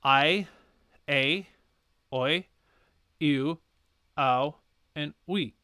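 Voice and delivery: I, a, oi, you, ow, we, one at a time; diphthong drill. Shown as a demonstration. Teacher reviewing all six Latin diphthongs. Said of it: "all combined into one sound".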